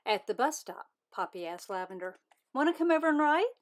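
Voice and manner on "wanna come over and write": eager. Acted. Eager invitation at bus stop.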